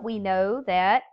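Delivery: ordinary talk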